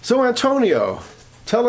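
mocking rich relative showing off at the dinner table; boastful cousin. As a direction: needle him in front of the family, pleased with yourself. Boastful rich cousin taunting Antonio.

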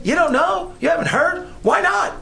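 high, disbelieving questions ending in a laugh; mock exasperation at a child who should know; incredulous parent. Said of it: "It's almost as if he's saying".

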